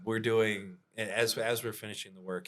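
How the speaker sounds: ordinary delivery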